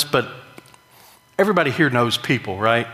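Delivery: ordinary talk, nothing marked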